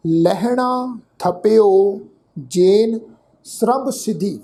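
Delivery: slow, drawn-out verse; measured Gurbani recitation; scripture recitation. Shown as a demonstration. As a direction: recite word by word with long vowels.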